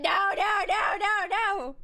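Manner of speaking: mock crying shouted no; mock panic. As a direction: wail no in hammed-up terror. Reacting to being Baker acted.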